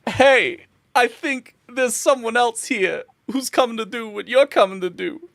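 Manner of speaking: high-pitched barkeep calling over; animated bartender. Acted. Bartender telling the Moogle about another adventurer, high and animated. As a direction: hail another customer in a high voice.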